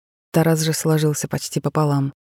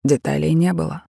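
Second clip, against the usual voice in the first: usual speaking voice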